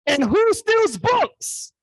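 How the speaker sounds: very high, loud, stretched outburst; slow, high-pitched incredulous yell; mock disbelief. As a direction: shout it in comic disbelief.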